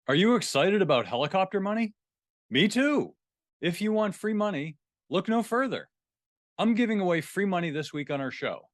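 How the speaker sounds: excited host pitching a giveaway to listeners; ad read. Acted. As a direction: sell it like a cheesy radio ad. Host reads a show ad.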